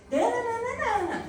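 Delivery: put-on high, slow baby babble with a laugh; imitating baby babble. Mother imitating her one-year-old's babble. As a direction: mimic a toddler scolding his doll. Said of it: "porque ele fazia assim".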